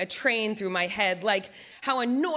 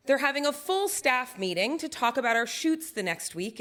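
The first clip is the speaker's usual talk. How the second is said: ordinary delivery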